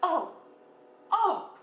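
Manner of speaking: high drawn-out oh of dawning surprise; mock realization. The hairdresser realizing she understands, high surprised oh. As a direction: let the penny drop, high. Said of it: "She's like".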